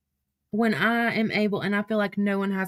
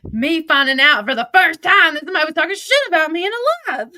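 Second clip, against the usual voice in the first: loud, high-pitched, breaking into a laugh; incredulous laughing; 7 semitones higher, 7 dB louder than usual. Real, not acted. Streamer tells viewers she's just found out, incredulous and laughing. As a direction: laugh it out loudly in real disbelief. Reacts live, laughing in disbelief.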